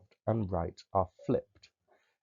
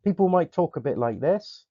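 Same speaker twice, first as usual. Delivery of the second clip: higher, lilting Somerset accent; 8 semitones higher, 5 dB louder than usual. Shown as a demonstration. Drama teacher showing regional accents, Somerset accent. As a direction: put on a broad Somerset accent. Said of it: "Where I come from in Somerset".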